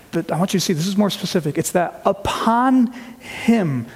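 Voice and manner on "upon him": "upon him" higher and very slow; stressed phrase. Acted. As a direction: stretch out "upon him" with weight. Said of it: "which is a sacrificial language".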